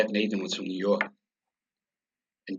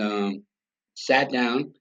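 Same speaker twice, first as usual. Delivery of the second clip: normal talking voice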